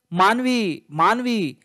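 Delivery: calling a name high and loud, full of fear; anguished calling. A narrator's reading. Father calling his hurt daughter, anguished and afraid. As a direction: cry out her name in panic. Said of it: "ఆ గొంతులో ఎంతో ఆవేదన, భయం, కంగారు".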